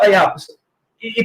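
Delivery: ordinary delivery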